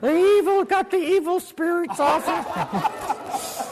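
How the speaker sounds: loud, high, hammed-up praying healer; praying grandfather. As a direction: imitate a healer crying out in fervent prayer. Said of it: "my grandfather was still praying".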